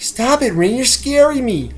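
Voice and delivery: whiny and scared, drawn out; Stimpy impression. Stimpy pleading with Ren, high dopey voice. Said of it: "Stimpy would always be like".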